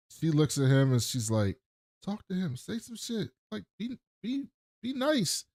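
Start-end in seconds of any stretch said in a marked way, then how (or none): 2.0-5.5 high, soft urging under the breath; hushed prodding. Retold or imitated. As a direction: nudge them quietly in a high, small voice. Chelsea quietly prodding Rick, retold.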